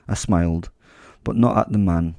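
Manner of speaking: ordinary delivery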